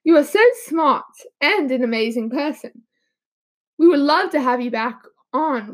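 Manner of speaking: put-on British accent, dropping the r's. Shown as a demonstration. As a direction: slip back into the host's British accent. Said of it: "I lost my accent".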